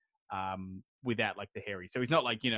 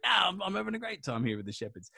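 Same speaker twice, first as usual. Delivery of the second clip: higher-pitched playful complaint; whiny protest; 7 semitones higher than usual. Retold or imitated. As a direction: protest like someone dragged away from fun. Enkidu protests being pushed to move.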